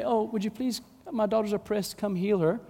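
mock-polite, restrained little plea; understated, courteous asking; polite request. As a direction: ask nicely, no urgency at all. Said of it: "So it's not this polite".

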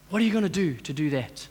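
low, soft, spaced-out challenge; hushed preaching. Real, not acted. Preacher closing with a question to congregation, hushed solemn appeal. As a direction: ask it quietly, with weighty pauses.

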